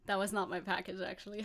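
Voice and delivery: ordinary delivery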